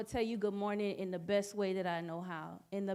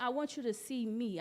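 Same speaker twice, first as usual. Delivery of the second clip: usual speaking voice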